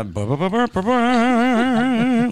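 loud, high sung organ riff; imitating organ music. Shown as a demonstration. Host answers a call for organ music, imitating organ. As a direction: belt out a sports-arena organ fanfare. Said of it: "Where's the organ music?".

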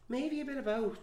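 high, lighter repeat; playful high echo. Pretend. Repeating the answer in a high teasing voice. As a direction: echo it again, still high.

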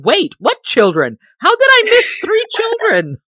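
climbing to a high, startled pitch; re-enacting confusion over her notes, high and surprised; mock bewildered. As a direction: replay your own double take, half laughing. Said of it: "I kept looking at my notes and going".

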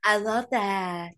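long drawn-out, loud gushing catchphrase; loud mock-gushing drawl; Lisa Barlow. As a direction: stretch every word out, hammed-up impersonation. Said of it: "Lisa Barlow".

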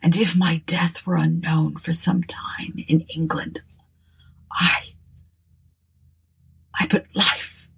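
dying words. Acted. Ruthven's dying request.

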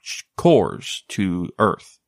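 ordinary talk, nothing marked

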